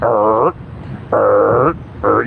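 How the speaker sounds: low rumbling mock stomach growl; imitating a stomach growling all night. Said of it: "growling all night".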